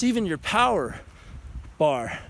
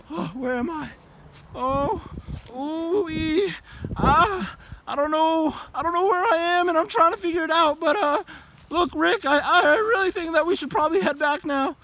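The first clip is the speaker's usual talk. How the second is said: put-on a high nervous boy's voice, lifted all through; Morty; 8 semitones higher than usual. Talking to Rick, a high nervous Morty impression. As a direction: hedge and fret like a worried kid. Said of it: "a better Morty".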